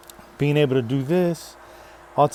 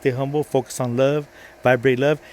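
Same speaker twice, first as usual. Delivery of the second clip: usual speaking voice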